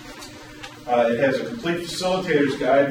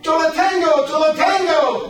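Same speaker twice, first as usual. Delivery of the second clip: high, loud screamed Yo la tengo; screaming; 14 semitones higher, 7 dB louder than usual. Retold or imitated. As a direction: scream it to claim the ball. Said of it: "Richie starts screaming".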